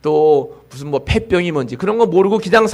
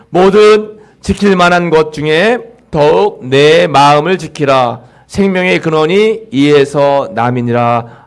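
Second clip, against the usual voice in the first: slow, louder solemn Bible reading; scripture reading; 5 dB louder, slower than usual. Shown as a demonstration. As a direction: read the verse slowly and weightily to the congregation. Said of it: "같이 읽어볼까요".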